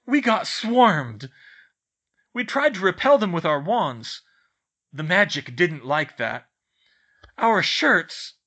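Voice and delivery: loud, high, broken bursts; rattled recounting. A narrator's reading. Twins recount being swarmed, loud and rattled. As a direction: exclaim each piece loudly, still rattled.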